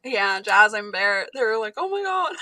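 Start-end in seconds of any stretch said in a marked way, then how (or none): 1.8-2.3 high-pitched mock dismay; imitating scared kids. Kids shocked by mom's order, mock-scared. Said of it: "They got scared".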